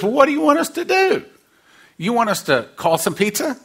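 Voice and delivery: high, fast, exasperated disciple voice. Acted. Disciple pressing Jesus about feeding the crowd, high and fast. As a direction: whine it up high like a fed-up follower.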